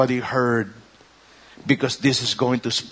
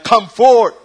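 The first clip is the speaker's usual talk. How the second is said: much higher, louder shout; commanding cry; 8 semitones higher, 9 dB louder than usual. Acted. Jesus calling the dead from the tomb, loud cry. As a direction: call it like a command.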